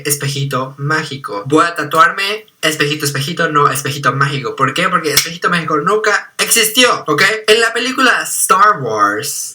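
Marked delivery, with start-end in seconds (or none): none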